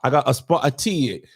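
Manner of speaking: exaggerated street English accent; cockney-style English patter. Shown as a demonstration. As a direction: show off a hammed-up English accent. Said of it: "my English accent is my strongest".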